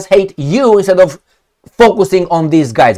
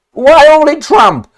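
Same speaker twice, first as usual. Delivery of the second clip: indignant outburst; 11 semitones higher, 6 dB louder than usual. Real, not acted. Indignant rhetorical question.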